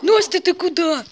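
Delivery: alarmed call. Real, not acted.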